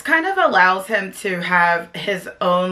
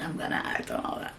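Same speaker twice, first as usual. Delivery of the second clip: joking breathy whisper, quick; 15 dB quieter, faster than usual. Pretend. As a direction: whisper it fast as a mock secret. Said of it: "No, I'm just kidding".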